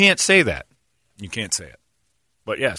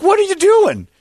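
mock shout; 6 dB louder than usual. Friends reacting to haircut.

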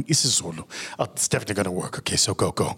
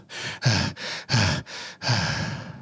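a long winded exhale; long panting sigh; 2 semitones lower than usual. Acted. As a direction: breathe out slowly as if short of air. Catching breath in water.

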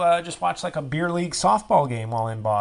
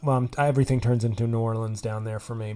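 usual speaking voice

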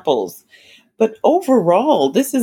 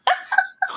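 breathy giggle; 5 dB quieter than usual. Real, not acted. Laughing about favorite movie.

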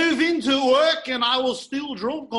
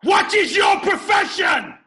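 battle cry; 9 semitones higher, 10 dB louder than usual. Acted. Friends shouting movie impressions.

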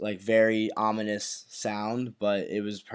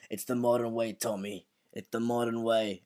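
softly voiced imitation, dropping away at the name; lightly acted, subdued character quote; quoted gunman; 6 dB quieter than usual. Host mimics the man firing the bullet, subdued put-on voice. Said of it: "he kept on saying when he fired the bullet".

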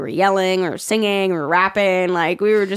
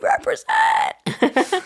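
mock hype shout; 11 semitones higher, much slower than usual. Hyping DJ in New York cap.

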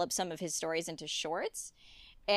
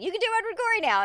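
high, fast, loud imitation of mom; mom's triumphant announcement; 14 semitones higher, 6 dB louder, much faster than usual. Mom back from confronting the art teacher, triumphant. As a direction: rattle it off high, mimicking mom.

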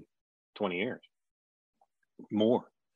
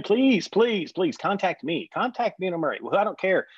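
high, louder desperate begging; pleading; 10 semitones higher, 4 dB louder than usual. Retold or imitated. Messaging a missing roommate, high pleading plea. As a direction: beg him to get in touch, overdone.

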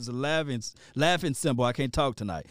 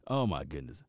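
ordinary delivery

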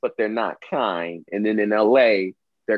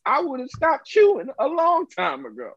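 mock indignant; 14 semitones higher than usual. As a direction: play the offended eater, voice pushed up high. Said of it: "You're like".

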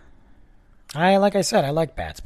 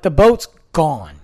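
emphatic slow announcement; 3 semitones higher, 9 dB louder, slower than usual. Retold or imitated. Telling guard the boat left.

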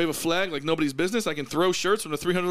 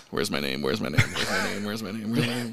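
low-pitched repeated murmur to himself; low self-talk; 8 semitones lower than usual. Acted. Reading the board of assignments for his name, low self-talk. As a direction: chant it low, as if scanning a list.

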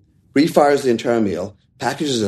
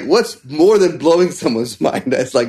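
normal talking voice

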